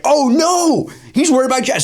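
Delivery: high, alarmed oh no; aghast at the stolen jacket; mock horror. Seeing Shelley in his coat.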